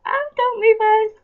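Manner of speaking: high, plaintive plea; pleading. Real, not acted. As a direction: beg her not to go. Farewell message, begging Becca stay.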